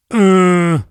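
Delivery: sudden loud uh; loud grunt. Retold or imitated. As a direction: blurt a dazed uh. His stunned reply retold.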